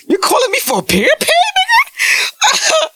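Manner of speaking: high, laughing disbelief, yelled out; incredulous mocking shout. To a girl calling sick with period pain, laughing incredulous shout.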